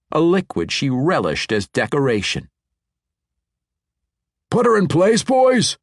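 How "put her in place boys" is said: much higher, louder shout to the crew; yelled order. A narrator's reading. Dwarf foreman yelling to his hauling team, loud shout. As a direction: bellow it across the bridge. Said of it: "Orethio Spikes yelled".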